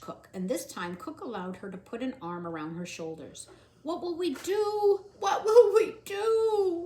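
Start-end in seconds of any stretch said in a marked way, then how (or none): 3.8-6.8 loud, high drawn-out wail. Acted. Cook wailing in Louise's arms. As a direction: wail it helplessly. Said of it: "wailed Cook".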